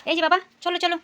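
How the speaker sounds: high, loud coaxing call. Real, not acted. Calling her small child to go.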